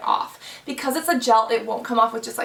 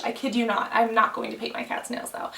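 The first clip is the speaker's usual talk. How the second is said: ordinary talk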